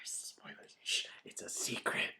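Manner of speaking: hushed secretive whisper. Pretend. Cohost whispering a spoiler warning.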